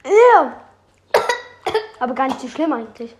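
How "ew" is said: loud high squeal of disgust. Real, not acted. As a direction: recoil with a sharp ew. Tasting chili powder.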